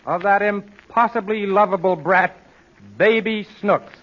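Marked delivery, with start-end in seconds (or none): none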